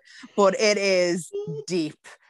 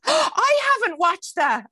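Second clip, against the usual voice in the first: gasping surprise; 12 semitones higher, 4 dB louder, slower than usual. Real, not acted. Gasp at unseen film.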